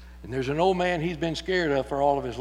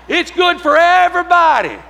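preaching shout; 13 semitones higher, 15 dB louder than usual. Acted. Preacher crying out to the whole congregation, shouting. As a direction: roar it to the back pews.

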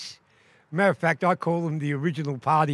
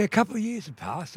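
plain reading voice

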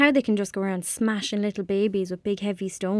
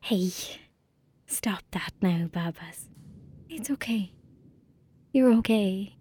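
soothing hush; 4 dB quieter than usual. Acted. Soothing crying kittens.

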